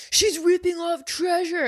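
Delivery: high, loud snide heckler; mock heckler. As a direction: sneer it like a rude heckler. Heckler voiced in retelling.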